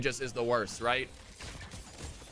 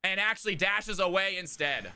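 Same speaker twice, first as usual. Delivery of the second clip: energetic game commentary; live play-by-play; 7 semitones higher than usual. Acted. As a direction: narrate the action with rising hype. Esports play-by-play casting.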